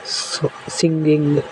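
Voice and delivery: Singing stretched out slowly, each syllable drawn long; slow example word. Shown as a demonstration. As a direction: draw out singing so the final ङ्ग is heard. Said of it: "जैसे". (Singing को धीरे-धीरे खींचकर बोलें)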